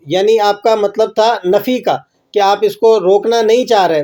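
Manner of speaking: ordinary talk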